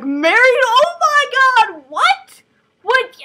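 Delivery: high, loud, stretched-out shriek of disbelief; shocked outburst. Acted. Tessa learns she is to marry, shocked shriek. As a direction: shriek it, rising on each question.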